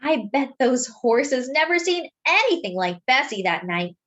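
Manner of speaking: usual speaking voice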